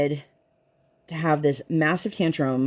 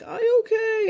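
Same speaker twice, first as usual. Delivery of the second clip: put-on high little girl voice. Injured toddler answers mom.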